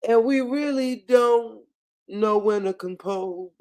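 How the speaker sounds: drip fake sympathy; sarcastic slow condolence; mock sympathy. Real, not acted.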